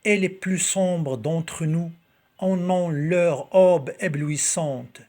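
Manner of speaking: slow, projected poetry reading; poem recitation. Acted. Reading Victor Hugo's poem to video viewers, slow declaimed recitation.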